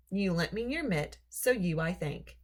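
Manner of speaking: plain reading voice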